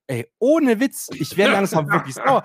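indignant rant at the company; mock anger. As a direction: lay into them, drawn out. Angry tweet to Hermes.

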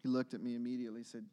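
usual speaking voice

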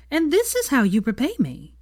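high, indignant disbelief; incredulous. A narrator's reading. Hermione scolds Draco, incredulous. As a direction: pitch it up in disbelief. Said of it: "said Hermione incredulously".

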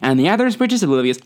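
ordinary talk

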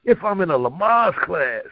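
rhythmic poetry performance, with pitch that rises high on the punchlines; spoken-word poem. Acted. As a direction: deliver it like a slam poet on stage.

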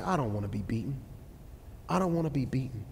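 low, soft resolve; subdued plea. Acted. Preacher speaking for broken people on the brink, low and subdued. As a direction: say it low and soft, twice over.

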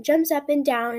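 usual speaking voice

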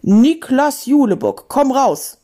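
loud, slow menacing summons; drawn-out thunderous call; booming voice. Acted. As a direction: boom the name out slowly. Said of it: "dröhnte eine Stimme".

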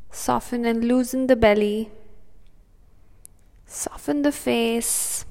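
soothing, unhurried breathing cues; calm meditative tone. Acted. Leads a guided meditation.

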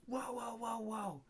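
a hint of a mocking neighbor lady. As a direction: imitate the shrill neighbor with a sneer. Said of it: "And she goes".

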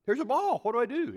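put-on high, loud flustered kid; mock panic. Kid in the outfield spotting the ball, mock panic. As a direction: act out a startled little outfielder.

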